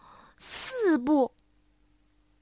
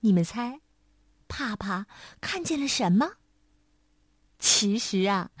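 plain reading voice

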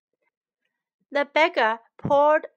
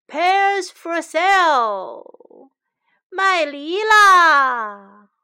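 loud, drawn-out street cry; market vendor's call; 5 semitones higher, 10 dB louder, much slower than usual. Acted. Shen hawking pears to the market, calling out loudly. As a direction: call out your wares, high and slow.

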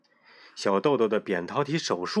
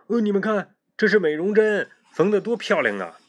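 put-on much higher, louder boastful boy; proud showing off; 9 semitones higher, 5 dB louder than usual. A narrator's reading. Boy shows girls his stitched scar, proud and loud. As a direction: show off the neat stitches proudly.

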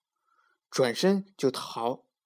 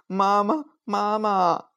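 calling for mom; 8 semitones higher, 3 dB louder, slower than usual. A narrator's reading. Lost child calling mother.

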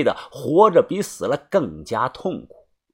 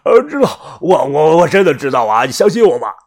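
sweating and desperate; 3 semitones higher, 6 dB louder than usual. Acted. Captive insisting to his captors he knows, louder and desperate. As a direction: beg them to believe him. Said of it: "杨老幺满头大汗地说啊".

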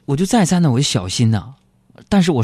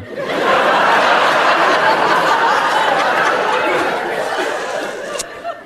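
long laughter. Real, not acted. Laugh after punchline.

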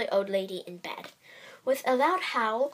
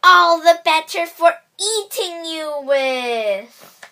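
slow, loud, high wolf snarl; menacing wolf; 6 semitones higher, 12 dB louder, slower than usual. Acted. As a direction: pounce with a big wolf roar. Wolf's menacing final reveal.

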